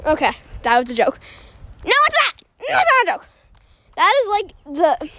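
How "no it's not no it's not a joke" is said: high, excited take-back of the retraction; mock insistent. Insisting the car rant was no joke, high and playful. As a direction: squeal it out, half joking.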